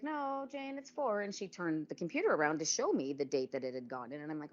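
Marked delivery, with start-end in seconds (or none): 0.0-1.2 a light high imitation of the receptionist, firm; insistent receptionist. Receptionist correcting the patient about the IUD date, lightly voiced and insistent. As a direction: correct her firmly in a lifted voice.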